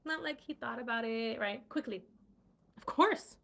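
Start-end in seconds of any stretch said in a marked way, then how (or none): 2.8-3.4 much higher and louder, eager; eager Terrence, quick and keen; quick eager reply. Acted. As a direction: jump in eagerly. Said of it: "Terrence said quickly".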